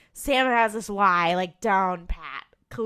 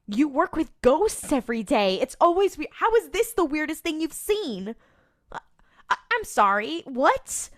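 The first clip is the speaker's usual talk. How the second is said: higher and louder, incredulous and exasperated; exasperated disbelief; 7 semitones higher, 4 dB louder than usual. Retold or imitated. Host ranting at Sam on the show, mock exasperated disbelief. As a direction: throw up your hands at the absurdity.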